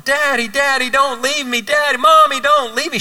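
put-on high frantic little girl crying out; little girl screaming after the departing car; child screaming. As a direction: cry out like a scared toddler left behind. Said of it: "She's screaming after the car".

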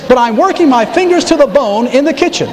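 high, complaining whine; hammed-up put-upon sister; whiny Martha. Martha whining high to Jesus about her work. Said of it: "I don't know why I'm doing this".

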